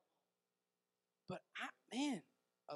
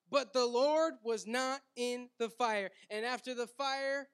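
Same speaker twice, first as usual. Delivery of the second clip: preaching scripture reading; 3 semitones higher, 4 dB louder than usual. Acted. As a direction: proclaim the verse to the congregation. Reads scripture at full force.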